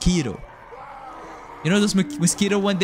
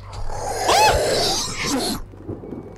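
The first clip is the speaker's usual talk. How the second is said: sharp gasp. Real, not acted. Horror moment in game.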